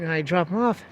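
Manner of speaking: ordinary talk, nothing marked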